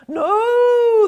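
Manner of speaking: high, loud no; high yelled no; crowd yell. Acted. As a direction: shout it back as a crowd. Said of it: "the crowd yelled".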